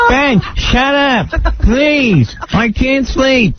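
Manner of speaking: high, slow, mock-tearful mother's whine; whining mom. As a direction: plead with the snoring husband, high and drawn out. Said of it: "mother scolding snoring husband".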